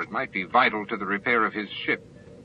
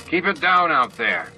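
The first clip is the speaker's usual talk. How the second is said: irritated, raised call from behind a panel; cross call; 6 semitones higher, 4 dB louder than usual. A narrator's reading. O'Brien calling out to the noisy bridge, crossly. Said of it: "a cross voice called".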